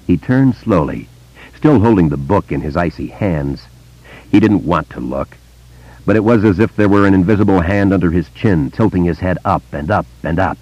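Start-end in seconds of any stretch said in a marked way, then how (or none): none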